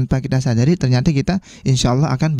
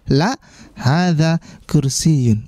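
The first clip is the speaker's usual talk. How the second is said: slow model reading; much slower than usual. Shown as a demonstration. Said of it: "Maka kita jawab tidak".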